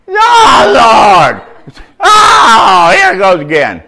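high, loud crying wail of regret; despairing cry. Retold or imitated. Brother locked in the county jail, a loud despairing cry. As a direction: wail as the cell door locks. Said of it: "My brother Richard said that".

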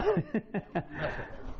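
quiet chuckle; soft laugh. Real, not acted. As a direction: chuckle softly to yourself. Laughing after his line.

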